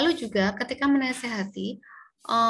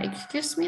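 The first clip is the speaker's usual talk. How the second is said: "excuse me" higher, lifting on "me"; rising intonation; 6 semitones higher, 3 dB quieter, slower than usual. Shown as a demonstration. Teacher compares two meanings of "excuse me", this one rising. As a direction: say "excuse me" with an upward questioning lift. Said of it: "sama-sama".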